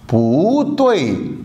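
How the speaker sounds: पू तोई slow, पू rising in second tone then तोई falling; tone change demo. Shown as a demonstration. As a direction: say पू तोई slowly, पू up, तोई down. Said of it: "पू से क्या बन जाएगी".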